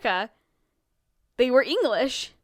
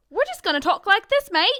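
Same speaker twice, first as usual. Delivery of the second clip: hammed-up Aussie voice, higher and louder; bad Australian accent; 7 semitones higher, 6 dB louder than usual. Friend mocking how Australians talk, bad Australian accent. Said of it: "Those were bad impressions".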